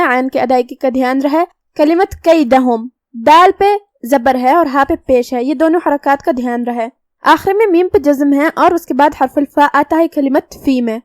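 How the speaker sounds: normal talking voice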